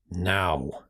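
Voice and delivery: low, growled command. Acted. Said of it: "I growled".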